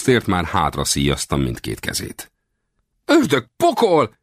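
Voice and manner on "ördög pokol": high, slow exclamation of outrage; shouted curse. A narrator's reading. Captured westerner coming to his senses, high cursing cry. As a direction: cry out the oath high and drawn out. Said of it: "Kiáltotta".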